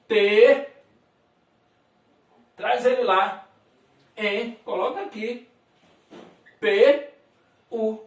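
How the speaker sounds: "T", "P", "U" said one by one, loud and separate; sounds spelled out. Shown as a demonstration. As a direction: call out each sound alone. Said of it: "o T você fala".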